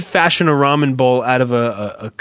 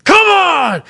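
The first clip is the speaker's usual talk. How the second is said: loud, high-pitched exasperated yell; agitated dad yelling; 7 dB louder than usual. Retold or imitated. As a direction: imitate an angry dad hollering from his truck. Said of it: "I could tell that he's like".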